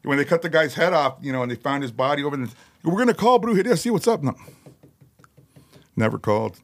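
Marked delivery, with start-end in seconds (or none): 2.8-4.2 pitched up, a bit louder, playing the cops; lightly voiced eager cop; imagined police calling the band, voiced high; mock cop voice.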